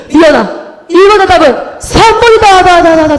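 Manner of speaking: booming, high, echo-like proclamation; imagined voice of God. Pretend. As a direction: announce it loudly, repeating the last syllable like an echo. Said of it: "내가 이렇게 기도하면 하나님이". (하나님 음성처럼 크게 울리는 흉내)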